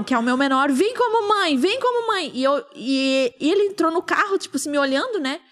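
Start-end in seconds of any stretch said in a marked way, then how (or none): 0.7-2.3 high, urgent mock yell; retold hysterical calling. Crying mother calling her younger son, hysterical. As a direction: call the child over in a high voice. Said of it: "gritando, assim, histericamente".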